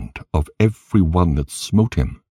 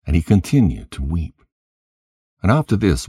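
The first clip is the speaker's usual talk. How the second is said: plain reading voice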